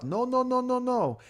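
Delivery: high, loud mock refusal; lot guy refusing. Teller voicing the lot guy turning him away, loud mock refusal. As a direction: bark the lot guy's flat no over and over.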